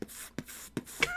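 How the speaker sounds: unvoiced finger-gun pff sounds; quiet breathy pew-pew of a pretend gun; gun noises. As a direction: blow out soft mock gunshots. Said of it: "and I'm going".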